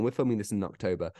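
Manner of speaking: normal talking voice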